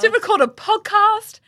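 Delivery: high, loud, stretched-out wail; hammed-up emotional gushing; retold tearful pride. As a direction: act overwhelmed by your own achievement. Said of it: "like, crying".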